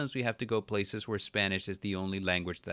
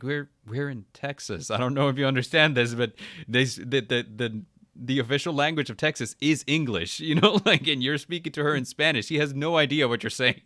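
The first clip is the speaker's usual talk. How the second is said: raised, emphatic mock exasperation; retold indignant argument; 5 semitones higher, 5 dB louder than usual. Husband defending wife to Spanish speakers, exasperated. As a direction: argue the obvious, building louder and higher.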